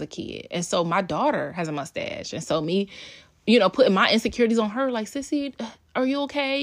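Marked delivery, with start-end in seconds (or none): 5.1-6.6 worried mom voice. Retold or imitated. Mother worrying to daughter.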